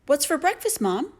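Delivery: high little bear voice asking Mom; little bear cub asking about breakfast; baby bear. A narrator's reading.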